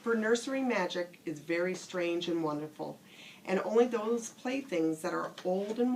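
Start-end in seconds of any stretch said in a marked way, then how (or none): none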